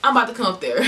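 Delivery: high, loud retold threat, the boyfriend fired up; boyfriend's loud outburst. Telling how her boyfriend reacted on the phone, loud mock outrage. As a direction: burst out like an angry boyfriend. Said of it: "and he's like".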